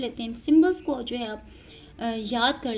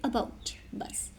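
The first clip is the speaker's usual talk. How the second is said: whispered demo word; 9 dB quieter, much slower than usual. Shown as a demonstration. Teacher whispers "about" to show the bout sound. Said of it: "main जो हमारा focus है वो है बी ओ यू टी पे".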